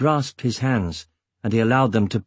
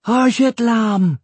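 high, loud, drawn-out cry of wonder; amazed exclamation; 12 semitones higher, 5 dB louder, slower than usual. A narrator's reading. Amazed dwarf children recognise Eragon, exclaiming. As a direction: call it out like thrilled children. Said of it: "they exclaimed".

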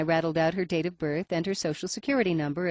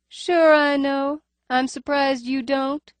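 high nasal voice, faint lisp; wary clerk, higher and nasal; 8 semitones higher, 6 dB louder, slower than usual. A narrator's reading. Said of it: "Her voice is higher ... nasal cast".

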